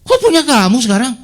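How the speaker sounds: loud, shocked protest; indignant outcry. Retold or imitated. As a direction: throw the question back in disbelief. Retold quarrel over lost shop. (protes keras penuh kaget)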